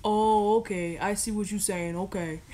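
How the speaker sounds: light mock boyfriend, agreeing. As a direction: act the boyfriend giving in. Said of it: "That's my reenactment of the conversation".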